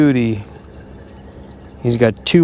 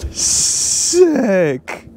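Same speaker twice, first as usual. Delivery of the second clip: excited; 10 semitones higher than usual. Real, not acted. Excited catch celebration.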